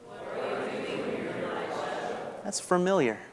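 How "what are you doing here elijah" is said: breathless hush, just air; gentle whisper. A narrator's reading. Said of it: "came a gentle whisper".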